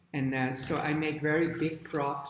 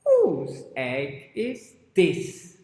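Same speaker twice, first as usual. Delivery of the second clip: slow, loud storybook question; questioning mother hen; 2 semitones higher, 8 dB louder, much slower than usual. Acted. Miss Hen asks about the big egg, slow and loud. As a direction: stretch each word, asking all around. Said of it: "And she says".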